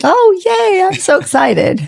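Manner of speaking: playfully mock-thrilled greeting; gushing excitement. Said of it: "I was like".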